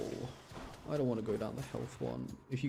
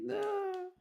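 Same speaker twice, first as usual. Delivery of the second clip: squeaky high "No."; startled cry. Real, not acted. Streamer's game glitches, startled high cry. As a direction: yelp at the glitch.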